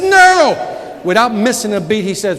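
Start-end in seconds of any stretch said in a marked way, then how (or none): 0.0-0.5 firm no. Retold or imitated. Repeated refusal to the boy.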